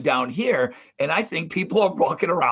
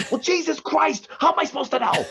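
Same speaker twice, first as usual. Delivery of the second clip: much higher, exasperated retort; exasperated sister; 10 semitones higher than usual. Retold or imitated. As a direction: throw up your hands like the sister. Sister's retort acted again.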